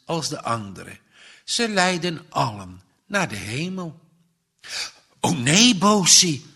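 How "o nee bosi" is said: put-on higher, louder child's protest; startled cry. A narrator's reading. Child to Bosi about his faith, startled cry. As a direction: exclaim it in shock, voice raised. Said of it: "roept het kind geschrokken".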